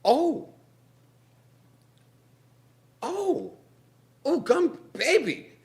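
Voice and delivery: high, loud and fast; frantic, hand stuck; panicked rant. Acted. Moesha stuck to the gum baby, panicking loudly.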